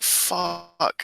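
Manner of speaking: long weary swear; bored groan. Retold or imitated. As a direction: draw it out like a bored student. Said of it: "I was really bored initially".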